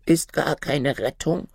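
a worried little raven asking; second raven. Acted.

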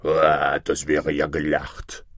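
low monster bird voice; big, low, boastful griffin; scoffing griffin. Acted. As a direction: scoff in a deep creature voice. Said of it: "rief der Vogel Greif".

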